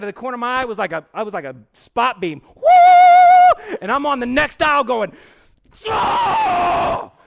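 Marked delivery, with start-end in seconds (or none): 2.6-3.8 shrill-pitched loud "woo"; high startled whoop. Retold or imitated. Man spotting his target in the store, loud high whoop. 5.9-7.1 long, loud, high "oh"; hammed-up cry of alarm; panicked yell. Man hiding on the next aisle, long panicked yell.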